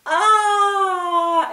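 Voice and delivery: high, loud admiring sigh. A narrator's reading. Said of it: "admired some of the others".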